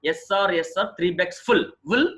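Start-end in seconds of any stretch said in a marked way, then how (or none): none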